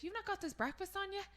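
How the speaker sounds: high-pitched cheeky question; teasing quote. Retold or imitated. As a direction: pipe it up high to surprise dad. Acts out teasing dad awake.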